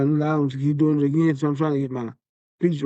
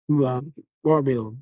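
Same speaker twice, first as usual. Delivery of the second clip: ordinary delivery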